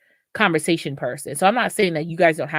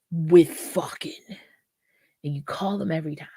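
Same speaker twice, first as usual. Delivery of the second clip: whisper. Real, not acted. Confiding about the casual partner.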